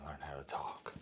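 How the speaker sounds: whispered self-scolding; whispering. Real, not acted. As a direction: hiss it under the breath. Scolding himself under breath.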